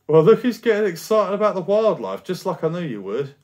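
high, loud, triumphant mum; excited teasing. Acted. Mum teasing her son for liking the village wildlife, triumphant and excited. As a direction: crow that you were right all along.

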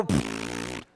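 low blown raspberry sound; toddler's rude pfft. Retold or imitated. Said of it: "taught her to go".

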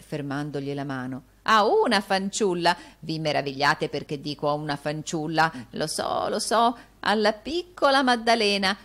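voce di vecchio più alta e forte; vecchio avaro insistente. Acted. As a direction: insiste goloso sul nome. Gride insiste sul brindisi. (vecchio che brinda alla piccola Maddalena)

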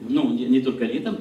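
ordinary delivery

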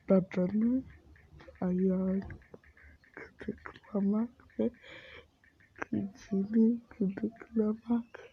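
slow hushed whisper. Real, not acted. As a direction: whisper it so dad won't hear. Secret plan near dad.

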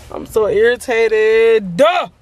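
drawn-out, hammed-up venting; slow comic exasperation; exaggerated irritation. Real, not acted. Exaggerated venting after laughing.

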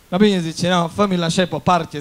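loud, brusque demand; irritated customer. Acted. Bald customer orders the barber, irritated.

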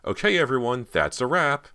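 high, loud gleeful call; cheerful shout to the others. A narrator's reading. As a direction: call it out brightly to the crowd. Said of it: "Cody's cheerful shout".

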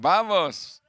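high, loud launch cry; rousing call. Real, not acted. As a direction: shout the crowd into action. Interpreter launching the group roar.

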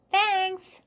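high, loud call; shouted thanks. A narrator's reading. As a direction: shout it after someone leaving. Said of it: "Jack shouted at the cat".

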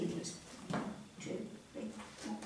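soft whispered counting; whispered counting in hide and seek. Acted. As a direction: breathe the numbers like a hider counting. Freddy counting with eyes covered.

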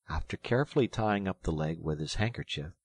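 plain reading voice

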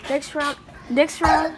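loud excited calling. Real, not acted. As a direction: call out loud to the others. Game restarts, calling out.

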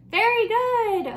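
excited praise. Real, not acted. Praising children's answer.

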